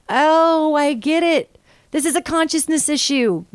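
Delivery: the penny drops, half laughing at herself; sudden realization. Retold or imitated. Recalling her own aha moment during the encounter, excited realization.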